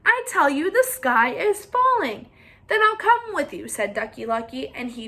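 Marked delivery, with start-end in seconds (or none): 0.0-2.3 insistent hen. Acted. 2.7-3.7 high, louder eager agreement; eager duck. Acted. Ducky Lucky eagerly joins Henny Penny, high and loud.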